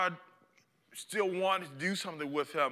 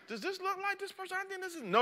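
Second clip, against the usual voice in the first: much higher, quick voice; nosy bystander; 13 semitones higher, 5 dB quieter, faster than usual. Acted. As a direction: compare the photo eagerly. Bystander checking phone, high.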